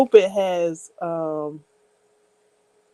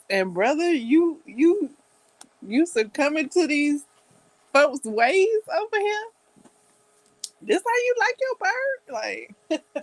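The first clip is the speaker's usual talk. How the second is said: mocking brother; 9 semitones higher than usual. Brother teasing Worf over the turkey, mock outrage. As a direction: tease him with a scandalised squeal. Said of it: "he was like".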